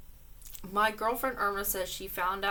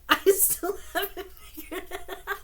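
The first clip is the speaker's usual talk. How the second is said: high, laughing through the words; can barely get it out for giggling; 10 semitones higher, 4 dB louder than usual. Real, not acted. Reading a fan letter aloud, laughing through it.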